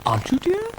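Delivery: very high, fluting ladylike voice; dotty lady cow. Acted. Ermintrude doubts Brian is himself, high fluting voice. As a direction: ask it in a high, dotty flute.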